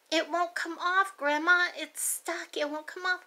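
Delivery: mild mock whine; whiny child. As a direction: kid insisting it's stuck. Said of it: "She's like".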